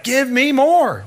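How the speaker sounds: eager demand. Retold or imitated. New convert hungry for the word of God, eager plea. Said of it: "It was like".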